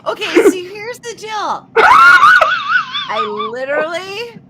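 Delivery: high, loud hammed-up Kansas twang. Doing her Kansas twang for the guys, loud. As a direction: shout it in a put-on country twang. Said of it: "go a little twang".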